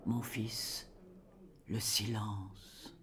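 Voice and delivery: ordinary talk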